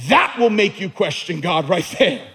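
usual speaking voice

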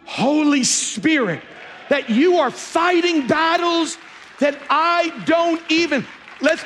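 high, loud, fervent preaching to the congregation; full-force preaching. Acted. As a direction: lift it up and preach it like a revival.

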